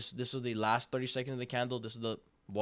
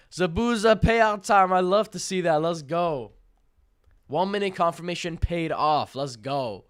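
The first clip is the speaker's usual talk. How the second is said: loud, raised-pitch celebration; excited shouting; 6 semitones higher, 10 dB louder, slower than usual. Real, not acted. Streamer celebrating a payout with chat, excited shouting. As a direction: cheer the win out loud, high and proud.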